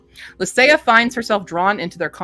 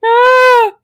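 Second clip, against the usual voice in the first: high loud delighted shriek; real delighted shriek; 10 dB louder than usual. Real, not acted. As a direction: shriek at the sight of succulents. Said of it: "That's what happens when I see succulents".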